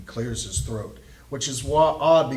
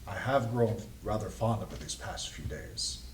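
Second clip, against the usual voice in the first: soft, low character voice, trailing quieter; borrowed voice; 6 semitones lower, 9 dB quieter than usual. Acted. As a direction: speak low and soft in a voice not your own. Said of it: "he's using his voice".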